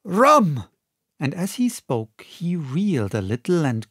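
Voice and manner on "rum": loud high demand; gasping call for rum. A narrator's reading. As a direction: cry out for rum. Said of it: "said he".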